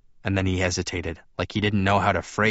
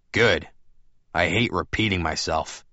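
usual speaking voice

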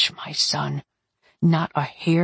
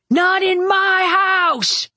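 huge slow roar, much higher and louder; 13 semitones higher, 4 dB louder, slower than usual. A narrator's reading. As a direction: roar it out to defend his home. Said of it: "Luther roared".

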